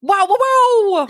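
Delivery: high bow-wow howl; dog bark. A narrator's reading. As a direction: imitate an excited dog barking. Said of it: "cried the curly-haired dog".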